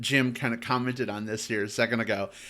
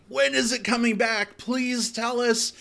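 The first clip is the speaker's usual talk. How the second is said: high-pitched imitation of eager fans; mock pleading; 11 semitones higher than usual. Imitates viewers begging for video.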